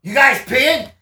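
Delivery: high, loud nosy stranger; imitating an intrusive stranger, loud; sudden loud interjection. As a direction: butt in loudly with a high-pitched question. Guy from nowhere suddenly interrupts.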